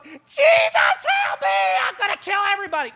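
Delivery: high, loud, hammed-up yell of desperation; frantic mock shriek, easing off at the end; inner scream. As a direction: scream it high, as if losing it inside. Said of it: "but inside, I was going".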